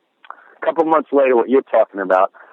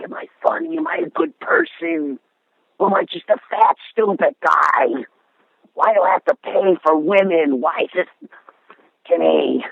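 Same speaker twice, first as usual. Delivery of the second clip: pleading cry for help; 7 semitones higher than usual. Retold or imitated. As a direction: imitate a desperate friend begging for an answer. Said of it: "he just kept saying".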